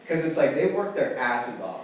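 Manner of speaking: normal talking voice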